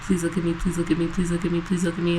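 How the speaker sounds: soft pleading, repeated quietly; mock pleading murmur. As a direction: murmur like a lovesick plea. Mimicking pining coworker.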